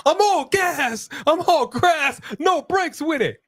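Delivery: very high, stretched put-on voice; high comic catchphrase. Commentator riffing on all gas, in a high mock voice.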